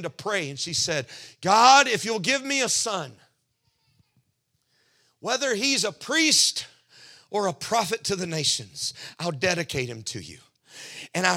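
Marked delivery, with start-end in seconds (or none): 1.4-9.9 raised, pleading plea to God; a mother's loud fervent vow; loud fervent prayer. Retold or imitated. Mother praying in vision.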